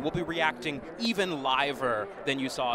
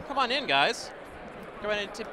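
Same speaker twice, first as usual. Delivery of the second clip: calling from far away; 7 semitones higher, 4 dB louder than usual. Real, not acted. Calling people in.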